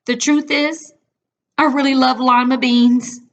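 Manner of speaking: high, loud gushing confession; eager confession. A narrator's reading. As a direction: blurt it out with joy. Confessing her love of beans.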